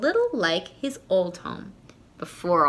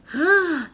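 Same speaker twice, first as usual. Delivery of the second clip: sharp high gasp; shocked gasp; 10 semitones higher, 5 dB louder than usual. Acted. Boy seeing his house painted pink, sharp high gasp.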